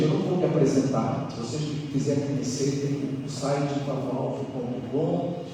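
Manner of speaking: ordinary delivery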